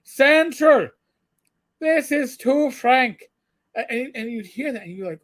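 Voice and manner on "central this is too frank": retold Irish cop's brogue on the radio, high and slow; high, slowed Irish brogue radio call. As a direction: put on a thick Irish brogue like a beat cop calling in. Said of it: "he had the full Irish brogue".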